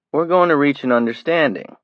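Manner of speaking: menacing. Acted. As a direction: threaten with false calm. Greg menacing the kid.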